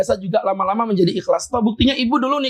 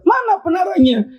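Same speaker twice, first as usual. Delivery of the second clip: indignant question; 9 semitones higher, 3 dB louder than usual. Retold or imitated. Retold demand about the minaret.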